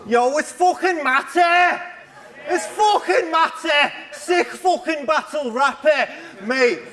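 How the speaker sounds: high, hammed-up Scouse shout; mocking Scouse accent. Battle rapper mocks his Scouse rival, loud exaggerated Scouse accent. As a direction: mimic a loud Scouser, stretched and high-pitched. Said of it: "The Scouse accent is loud and intrusive".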